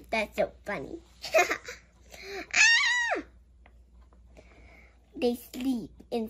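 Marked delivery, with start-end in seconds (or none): 2.5-3.2 very high excited squeal; thrilled high cry; excited shriek. Real, not acted. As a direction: shriek with delight. Excited at picture.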